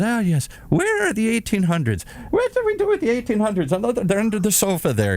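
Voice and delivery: a dithering little mouse of a nun; high, flustered Irish nun. Retold or imitated. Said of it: "He says".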